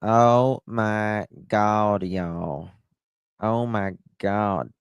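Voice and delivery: slow, drawn-out Southern belle impression; Blanche Devereaux. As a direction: draw it out like a theatrical Southern diva.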